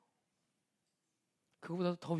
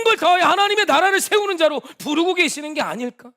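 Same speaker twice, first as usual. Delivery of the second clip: much higher, louder fervent shouting; retold shouting; 10 semitones higher, 14 dB louder than usual. Pastor retelling shouting at his friend to give, loud, high. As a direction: shout it out like a preacher at full force. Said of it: "제가 막 소리를 질렀어요".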